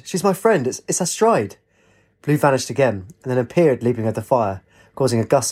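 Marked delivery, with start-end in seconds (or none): 0.0-1.6 high, urgent protest; understated scream. A narrator's reading. Katie defends her bird friend from Blue, understated scream. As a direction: plead it in a raised pitch, without shouting. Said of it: "Katie screamed".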